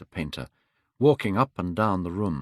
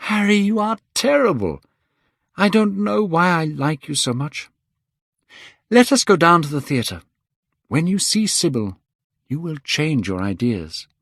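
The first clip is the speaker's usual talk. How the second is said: much higher, louder youthful outburst; higher, louder young man, delighted; young Dorian, exuberant; 9 semitones higher, 4 dB louder than usual. Acted. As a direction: scold Harry fondly, bursting with joy. Dorian's fond exclamation.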